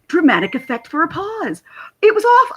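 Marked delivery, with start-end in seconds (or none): 2.0-2.5 excited. Real, not acted. Delight at the fake-out.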